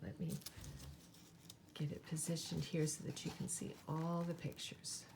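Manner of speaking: usual speaking voice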